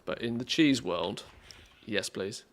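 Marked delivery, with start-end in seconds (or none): none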